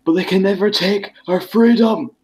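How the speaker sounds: put-on Braveheart-style Scottish accent, rising high on freedom. Joking with friends about Scots, a mock Scottish accent. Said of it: "when you were doing your Scottish accent".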